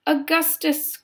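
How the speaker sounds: high-pitched motherly call. A narrator's reading. Missus Gloop to her son at the river, calling out. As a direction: call his name sharply upward. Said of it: "called out Missus Gloop".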